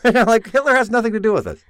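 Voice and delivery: high-pitched, quick objection; exasperated protest. Real, not acted. Objects to Hitler being brought up.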